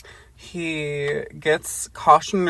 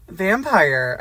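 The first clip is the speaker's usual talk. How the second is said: dramatic reading; 4 semitones higher, 6 dB louder than usual. Acted. Reading ancient word dramatically.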